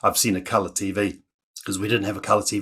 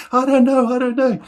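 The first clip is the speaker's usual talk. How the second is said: high-pitched helpless protest; acted panic, voice way up; panicked child; 14 semitones higher, 5 dB louder than usual. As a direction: answer in a flustered kid's high voice. Panicked child answers brother in story.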